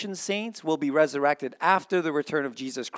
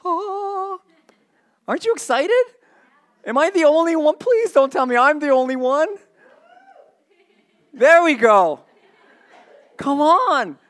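much higher, louder, pleading for a response; real excitement; 14 semitones higher, 10 dB louder than usual. Real, not acted. Preacher urging the congregation to cheer, excited. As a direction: beg the room to share the thrill. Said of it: "I'm getting so excited".

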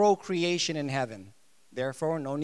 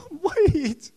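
startled; 11 semitones higher, 6 dB louder than usual. Retold or imitated. Mock shock at no marriage.

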